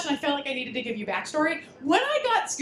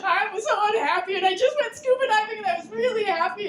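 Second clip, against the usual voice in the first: laughing confession; 8 semitones higher than usual. Retold or imitated. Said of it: "I'm like".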